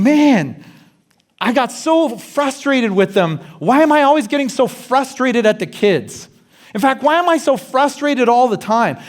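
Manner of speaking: mock self-scolding; frustrated inner voice. Voicing self-critical inner thoughts.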